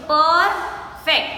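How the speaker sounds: "Perfect" split slowly as per, then fect; broken into syllables. Shown as a demonstration. Teacher showing pupils where the word breaks, "Perfect" in slow syllables. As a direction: say "Perfect" in two separated beats. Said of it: "so we break the word".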